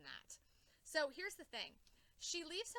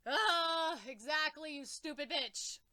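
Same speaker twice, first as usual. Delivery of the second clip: louder mock scolding of a character; 3 semitones higher, 10 dB louder, slower than usual. Fan yelling at the villain, loud mock scolding. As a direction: yell it at the villain. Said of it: "And I'm like".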